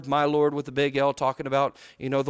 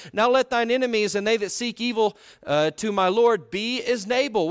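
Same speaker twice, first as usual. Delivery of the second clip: raised, solemn King James reading; higher, louder solemn reading; scripture reading; 6 semitones higher, 4 dB louder than usual. Acted. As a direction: proclaim the verse from the pulpit. Reads scripture aloud.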